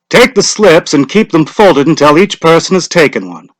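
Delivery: a hint of a village official calling out; lightly voiced lottery official; Mr. Summers' voice. A narrator's reading. Mr. Summers instructing.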